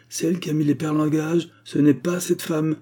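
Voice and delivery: high-pitched, confident defence; spirited. A narrator's reading. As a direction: argue it with verve. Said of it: "intervint Agar avec entrain".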